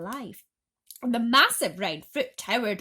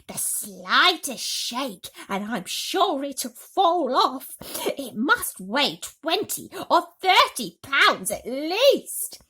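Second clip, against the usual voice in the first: fretful aunt; 6 semitones higher, 8 dB louder, slower than usual. A narrator's reading. Aunt warns about the peach.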